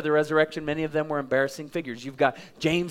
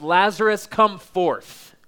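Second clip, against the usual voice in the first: higher, louder call into the tomb; commanding shout; 4 semitones higher, 6 dB louder, slower than usual. Acted. Jesus calling Lazarus from the tomb, a commanding shout. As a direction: call the dead man out. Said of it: "Jesus shouts".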